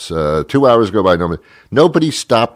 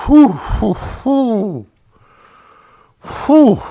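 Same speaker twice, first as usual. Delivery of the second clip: high whistling whew; much slower than usual. Retold or imitated. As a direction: blow out a shocked high whew. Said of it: "He was so angry".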